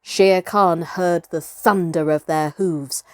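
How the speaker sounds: usual speaking voice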